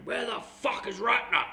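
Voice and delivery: a deliberately bad Sean Connery impression, angry. Connery phoning the writers, bad Scottish impression, slow and angry. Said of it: "is that your Sean Connery".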